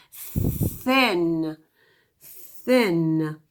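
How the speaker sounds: thin with an airy, unvoiced th held long; slow voiceless thin. Shown as a demonstration. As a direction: breathe out the th in thin, throat still. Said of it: "and let's say it".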